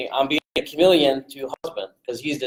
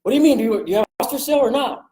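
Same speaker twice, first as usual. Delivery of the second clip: firing right back; 9 semitones higher, faster than usual. Shown as a demonstration. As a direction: snap it straight back at him, no backing down. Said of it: "I'm right back at 'em".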